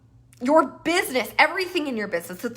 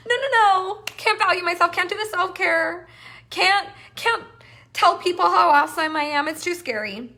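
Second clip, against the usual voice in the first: higher, louder panicky inner voice; mock self-doubt; 6 semitones higher, 3 dB louder than usual. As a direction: play your anxious self, hammed up. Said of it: "other times I'm like".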